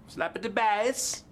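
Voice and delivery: put-on Borat accent. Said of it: "That sounded like Borat".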